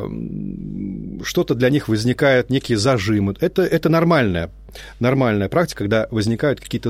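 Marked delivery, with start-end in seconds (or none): none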